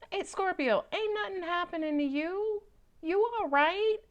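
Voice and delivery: high, sing-song teasing voice; playful check-in. Acted. Teasing a scared Scorpio that nothing is happening, high and playful. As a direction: pitch it up high and tease gently.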